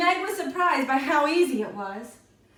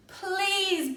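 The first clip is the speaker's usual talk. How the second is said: put-on higher pleading child voice; begging child; 8 semitones higher than usual. A narrator's reading. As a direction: plead like a kid. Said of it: "begged Meg and Sean together".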